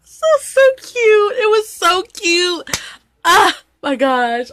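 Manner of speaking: crying while laughing, high and slow; teary and moved. Real, not acted. Moved to tears by a drama's ending, teary high voice. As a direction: let the tears break through the words. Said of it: "I'm so emotional".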